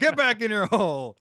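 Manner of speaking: hammed-up high, loud laughing voice; bad acting. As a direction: ham it up, shouting through a laugh. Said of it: "just walk it right back down and go".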